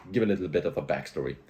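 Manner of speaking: ordinary talk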